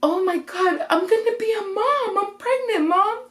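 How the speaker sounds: high, crying squeal retelling the news; very high-pitched, overwhelmed weepy voice; tearful joy. Retold or imitated. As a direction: re-enact bursting into happy tears. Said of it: "And I just start crying".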